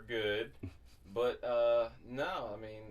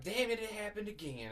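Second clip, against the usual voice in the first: high-pitched mock dismay through laughter; hammed-up high quote; exasperated woman, imitated; 13 semitones higher than usual. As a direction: play her lament way up high, amused. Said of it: "she was like".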